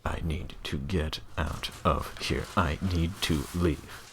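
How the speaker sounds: slow, quiet, dragged-out dread; mock dread. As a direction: mutter the exit in dismay. Acted panicked exit, slow and soft.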